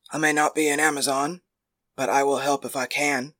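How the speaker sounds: a hint of a modest man; Philocides voice.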